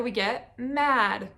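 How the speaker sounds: blending the word slowly. Shown as a demonstration. Teacher to child blending sounds, slow drawn-out mad. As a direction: stretch each sound of mad out gently. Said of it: "And if we put it together".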